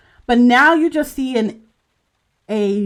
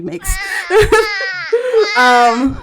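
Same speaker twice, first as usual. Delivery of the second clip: laughing; 11 semitones higher, 6 dB louder than usual. Real, not acted. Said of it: "you know how the fucking sound".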